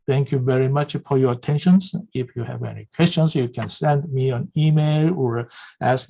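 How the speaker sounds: quick, hurried wrap-up; rushing to finish. Real, not acted. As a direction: rattle through the closing thanks. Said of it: "sorry for the hurry".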